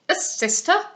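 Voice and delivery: scoffing disbelief, rising at the end; incredulous echo. A narrator's reading. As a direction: throw it back as an affronted question. Captain echoes the proposal incredulously.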